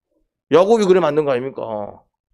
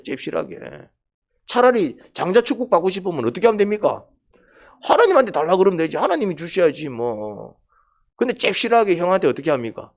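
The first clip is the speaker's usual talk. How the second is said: ordinary talk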